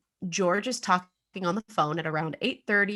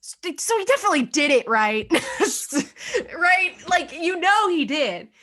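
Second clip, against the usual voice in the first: much higher and louder, laughing between the questions; excited certainty; 10 semitones higher, 5 dB louder than usual. Real, not acted. Host to co-host, sure he's guilty, excited and laughing. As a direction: push the listener to agree he's guilty. Host insists the father did it.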